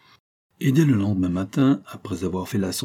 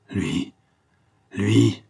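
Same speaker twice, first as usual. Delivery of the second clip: terrible cry. A narrator's reading. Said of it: "fait-elle avec un accent terrible".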